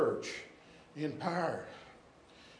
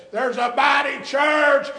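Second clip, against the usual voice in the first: loud, ringing preacher's cry; full-force preaching; 9 semitones higher, 10 dB louder than usual. Acted. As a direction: preach it out to the whole church.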